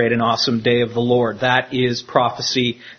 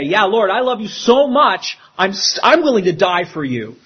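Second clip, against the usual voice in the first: eager, higher, gushing promise; mock gung-ho; 5 semitones higher than usual. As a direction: play it like an overeager disciple swearing loyalty. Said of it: "to go all gung-ho and say".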